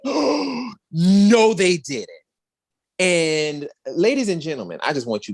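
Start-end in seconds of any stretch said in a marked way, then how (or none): 0.0-2.1 shocked disbelief. Retold or imitated. Shocked reaction to stunt.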